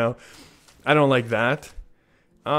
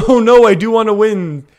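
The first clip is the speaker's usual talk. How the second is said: playful loud dismay; 8 semitones higher, 9 dB louder than usual. Real, not acted. Losing unexpectedly, laughing.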